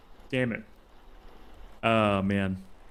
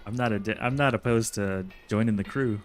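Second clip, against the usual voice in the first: ordinary talk, nothing marked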